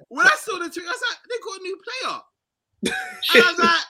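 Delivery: excited disbelief. Real, not acted. Excited retelling about the tweet.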